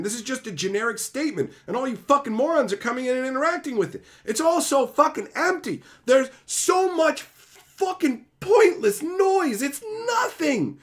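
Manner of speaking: building from irritation to a furious peak; escalating angry rant. Real, not acted.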